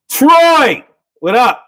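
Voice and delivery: loud, high hail to a friend; calling out. Real, not acted. Greeting Troy joining the stream, called out loud. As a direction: call out his name, excited.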